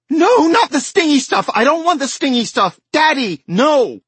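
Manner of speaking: put-on high, loud little girl's screaming protest; child screaming. Ellie screaming at Daddy not to use the stingy stuff. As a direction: scream it like a terrified little girl. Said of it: "she began to scream louder than ever".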